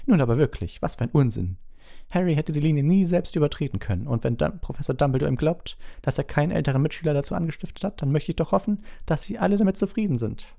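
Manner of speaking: agitated, high-pitched protest; McGonagall. A narrator's reading. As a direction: object heatedly, high and urgent. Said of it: "sagte Professor McGonagall aufgebracht". (aufgebracht, mit hoher Stimme)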